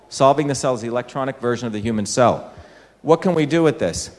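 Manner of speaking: ordinary delivery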